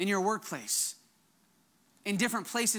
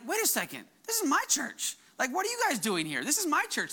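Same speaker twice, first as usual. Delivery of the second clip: mock-indignant, pitch jumping higher on "my"; sassy ownership; 6 semitones higher than usual. Church member telling new families this is her church, playfully sassy. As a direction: play the newcomer who claims the church as hers, lightly sassy. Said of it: "a healthy sass".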